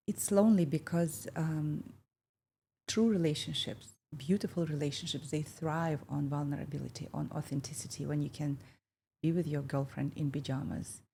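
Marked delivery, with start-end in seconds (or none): none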